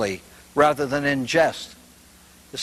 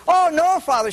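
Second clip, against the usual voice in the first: put-on old lady's reply; 13 semitones higher, 4 dB louder than usual. Old lady answers priest.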